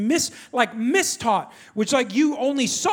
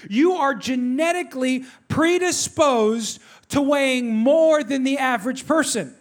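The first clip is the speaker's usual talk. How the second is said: slow, deliberate announcement; emphatic readout; 2 semitones higher, slower than usual. Retold or imitated. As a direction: spell out the verdict word by word. Reading out test result for effect.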